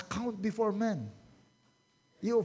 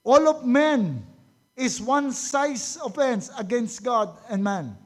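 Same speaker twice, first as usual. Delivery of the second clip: much louder, slow and weighty; loud slow emphasis; 6 dB louder, slower than usual. Real, not acted. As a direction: drive the point home loudly. Preacher emphatic declaration. (malakas at mabagal na diin)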